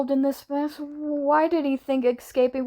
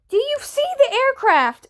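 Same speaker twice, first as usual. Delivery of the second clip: high, raised urgent question; urgent shouting; 11 semitones higher, 4 dB louder than usual. A narrator's reading. Wilbur asks Tubbo about the aircraft, urgently shouting. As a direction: call it urgently over the radio.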